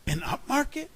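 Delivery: high, rising exclamation; astonished question. A narrator's reading. Hedge fund hearing the news, astonished question. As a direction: ask as if it's too good.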